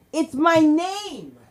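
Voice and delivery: ordinary talk, nothing marked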